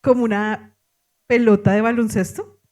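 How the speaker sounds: ordinary talk, nothing marked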